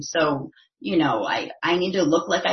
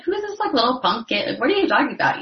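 higher, incredulous retort; mock indignation, pitched up and quick to fire back; indignant; 7 semitones higher, 4 dB louder, faster than usual. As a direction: act out an affronted comeback. Retells own indignant reaction to criticism.

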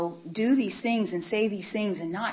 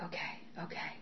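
whispered okay; 13 dB quieter than usual. Retold or imitated. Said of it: "And I was like".

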